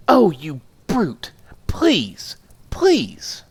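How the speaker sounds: high, slow, rising to a pitched plea; pleading wife. Acted. Wife begs her husband to lift her down. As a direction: give in and beg.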